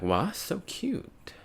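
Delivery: drawn-out high coo over a small warrior. Retold or imitated. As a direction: coo over something cute. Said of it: "she's like".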